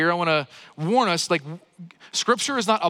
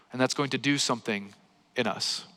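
ordinary talk, nothing marked

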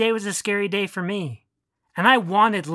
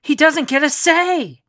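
loud, high, slow shout; furious narrated scream; 7 semitones higher, 4 dB louder, slower than usual. A narrator's reading. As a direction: yell it out in anger. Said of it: "I screamed".

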